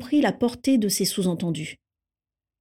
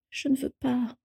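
soft, higher-pitched plea; murmur; 5 semitones higher, 5 dB quieter than usual. A narrator's reading. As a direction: murmur it small and high. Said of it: "murmura Andromeda".

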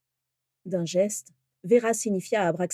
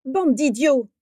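loud, higher-pitched scolding; sharp reprimand; 5 semitones higher, 5 dB louder, slower than usual. A narrator's reading. Vera scolding the two quarrelling men, sharp reprimand. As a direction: tell them off hard. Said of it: "les réprimanda vertement Vera".

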